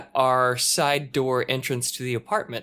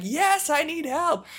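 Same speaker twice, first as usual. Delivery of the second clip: mock scream; slower than usual. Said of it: "he's screaming".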